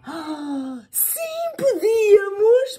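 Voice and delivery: high, loud, slow delight; high, loud, drawn-out glee; eager. Acted. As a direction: jump at the idea happily. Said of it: "propôs a mãe".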